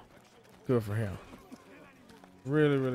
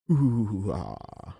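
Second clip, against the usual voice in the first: evil voice; 2 semitones lower, 4 dB louder than usual. Acted. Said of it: "I guess that's my evil voice".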